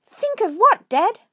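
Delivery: high, loud excited little cub; a cub asking eagerly, pitched high; eager child fox. Acted.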